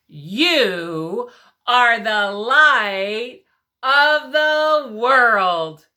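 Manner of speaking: each word called out slow and strong; loud slow recitation. Shown as a demonstration. Teacher calling the verse while children move, loud and slow. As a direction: proclaim it big for the class. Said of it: "One, two, three".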